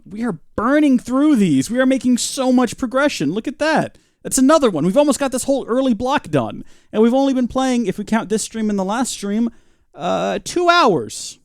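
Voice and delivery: high, upbeat over-the-top bragging; mock boasting. Streamer ironically boasting to chat, mock excitement.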